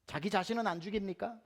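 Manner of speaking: ordinary talk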